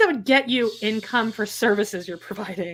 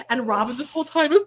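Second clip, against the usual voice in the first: ordinary talk, nothing marked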